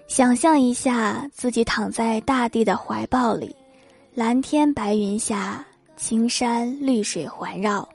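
slow, soft relaxation guidance; therapist voice. Retold or imitated. Therapist guides patient to relax, slow and soft. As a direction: lull him like a calm therapist.

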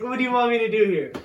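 ordinary delivery

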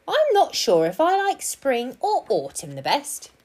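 high little squirrel voice; musing squirrel. A narrator's reading. Squirrel not really listening to Percy, absent-minded.

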